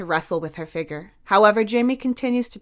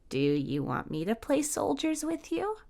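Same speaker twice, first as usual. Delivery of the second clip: soft offer; 8 semitones higher, 6 dB quieter than usual. A narrator's reading. Softly offers to play.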